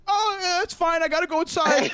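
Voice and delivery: high-pitched flustered excuse; nervous, desperate to get away; panicky brush-off. Retold or imitated. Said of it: "And he's like".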